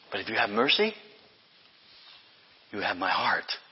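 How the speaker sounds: soft heartfelt promise; tender hush. Acted. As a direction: drop to a tender near-whisper. Tender close of the quote.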